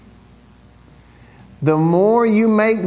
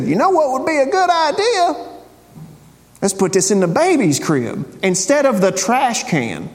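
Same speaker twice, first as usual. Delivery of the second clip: mock clueless customer; 9 semitones higher than usual. Voicing a foolish customer.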